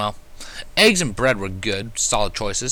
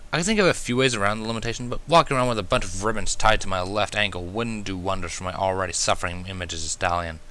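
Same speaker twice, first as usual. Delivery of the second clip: plain reading voice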